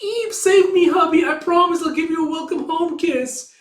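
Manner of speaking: put-on very high, loud girly plea; cutesy damsel. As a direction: squeal like a helpless damsel to her hubby. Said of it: "imagining her like".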